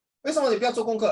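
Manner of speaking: quick, high parent questioning; nagging parent. Acted. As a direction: rattle it off like a parent challenging a child. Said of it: "imagine you talk to mommy".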